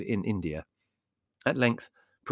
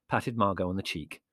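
ordinary delivery

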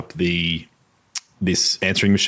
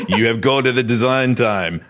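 higher-pitched mock French accent; Philippe Stark's French accent; 5 semitones higher, 4 dB louder than usual. Podcaster imitating a designer's answering machine, mock French accent. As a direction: put on a playful, hammed-up French accent. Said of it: "Can you please do the accent?".

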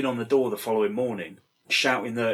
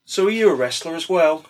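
mock condescending question, louder; 3 semitones higher, 7 dB louder than usual. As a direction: talk down to him like a smarmy radio host. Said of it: "really condescending way".

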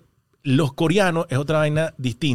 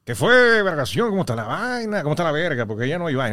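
imitated Maracaibo sing-song; Maracucho accent. Comedian asked to imitate a maracucho, Maracucho accent. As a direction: greet in a put-on Maracucho accent. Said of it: "imítame un maracucho".

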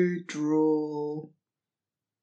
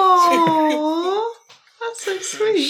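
high, cooing delight at a sweet story; gushing aww; 13 semitones higher, 8 dB louder, much slower than usual. Real, not acted. Friend cooing over the Ludwig story, high and touched. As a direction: melt at the kindness, voice way up.

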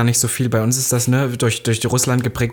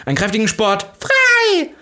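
put-on children shouting; 10 semitones higher, slower than usual. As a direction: shout frei like kids in formation. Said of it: "mussten alle Kinder schreien".

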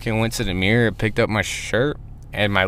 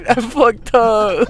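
higher, slow, laughing plea to dad; giggling to dad, much higher and slow; laughing confession; 12 semitones higher, 4 dB louder, much slower than usual. Retold or imitated. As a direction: laugh it out like a kid owning up. Voicing himself to dad.

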